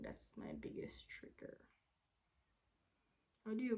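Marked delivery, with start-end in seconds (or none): 0.0-1.6 whispered conclusion. Real, not acted. Drained conclusion after the rush.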